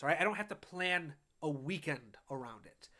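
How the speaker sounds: ordinary talk, nothing marked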